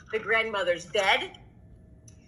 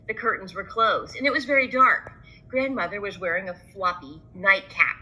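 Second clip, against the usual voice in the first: ordinary delivery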